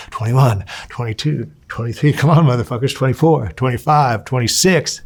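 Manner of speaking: retold gym hype; rep-counting cheer. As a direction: egg the lifter on, punching each number. Spotters counting bench reps, egging on.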